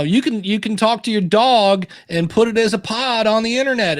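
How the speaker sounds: imitation Southern guy; Southern accent. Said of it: "the guy has a Southern accent".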